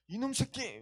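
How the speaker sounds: mock scolding. Shepherd berating the lost sheep he found, mock scolding. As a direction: act out scolding a runaway. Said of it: "하면서 막 하면서 혼내키거나".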